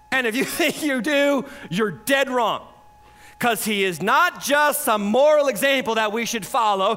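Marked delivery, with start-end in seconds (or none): 3.4-7.0 emphatic preaching that builds to a drawn-out peak; full-force preaching. Real, not acted. Preacher proclaiming to church who Jesus is, building full-force preaching. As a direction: hammer each claim, rising to a stretched shout.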